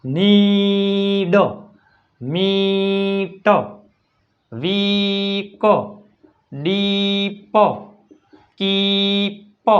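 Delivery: નીડ, મીટ, વીક, ડીપ, કીપ very slowly, each "ee" held long. Shown as a demonstration. Teacher showing double E between consonants, long ee. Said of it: "દીર્ઘાય ઈ".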